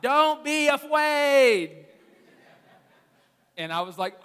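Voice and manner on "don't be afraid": put-on high, loud, slow little girl's shout; child shouting. Four-year-old shouting the answer in church, high and loud. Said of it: "Juliet just shouts out".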